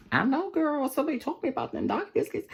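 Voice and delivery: high, quick sassy put-on voice; high-pitched mock smugness; sassy know-it-all. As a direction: boast in a high girlfriend voice.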